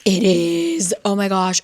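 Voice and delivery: a reader thrilled to find the twist; real excitement. Real, not acted. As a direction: blurt it out delighted. Excited at Nina's POV.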